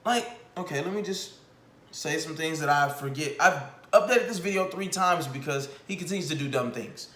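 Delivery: ordinary talk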